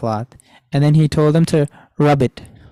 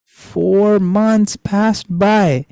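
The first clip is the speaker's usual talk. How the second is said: drawn out very slowly, each word stretched; slow emphatic repeat; 4 semitones higher, much slower than usual. Shown as a demonstration. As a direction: spell it out slowly so the class catches it. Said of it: "How many months?".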